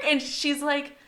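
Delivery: ordinary talk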